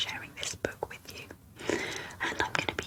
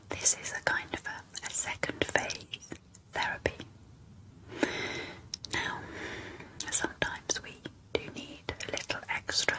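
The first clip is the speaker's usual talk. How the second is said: asmr whisper. Real, not acted. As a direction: whisper every word close and gentle. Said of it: "in an A S M R whisper".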